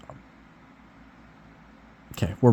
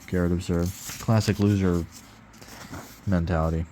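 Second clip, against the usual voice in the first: ordinary delivery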